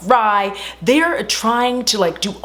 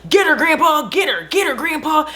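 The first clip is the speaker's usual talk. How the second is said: mock cheering; 8 semitones higher than usual. Family cheering grandpa on.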